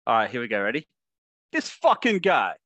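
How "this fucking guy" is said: high, loud, drawn-out Australian delivery; Australian catchphrase with a falling finish; Australian accent. Shown as a demonstration. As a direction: punch it out slowly in broad Australian. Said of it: "practiced an Australian accent yet".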